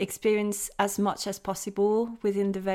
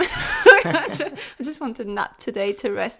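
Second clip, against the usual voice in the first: laughing; 4 semitones higher, 8 dB louder than usual. Real, not acted. Laughing at own remark.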